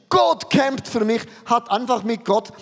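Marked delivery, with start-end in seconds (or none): 0.1-1.2 higher and louder, proclaimed; exclaimed quote. Retold or imitated. Preacher voices Jakob proclaiming his new name, exclaimed. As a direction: proclaim it as Jakob's triumphant cry. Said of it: "Und Jakob sagte eigentlich".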